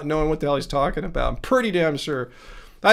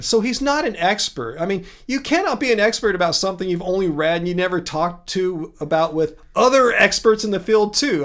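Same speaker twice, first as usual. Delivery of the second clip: usual speaking voice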